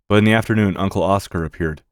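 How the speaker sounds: plain reading voice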